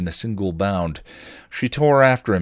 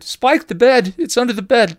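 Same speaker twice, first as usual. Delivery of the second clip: much higher, louder panicked scream; screamed warning; 9 dB louder than usual. A narrator's reading. Dawn screaming to Spike about the creature, panicked. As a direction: shriek the warning in terror. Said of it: "Dawn screamed".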